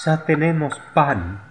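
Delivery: high excited shout; children shouting. A narrator's reading. Children shouting they have bread. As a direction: call it out like hungry kids.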